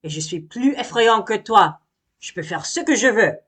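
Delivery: bully the little bee; angry bragging bear; angry bear boast. Acted. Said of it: "Monsieur Ours s'énerve".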